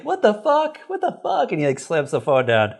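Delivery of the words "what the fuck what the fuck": higher, stunned disbelief; baffled Greg. Retold or imitated. Greg reacting to Cindy on the phone, higher baffled voice. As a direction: react like he can't believe it. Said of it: "then Greg's like".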